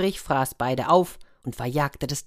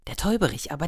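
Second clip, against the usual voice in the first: plain reading voice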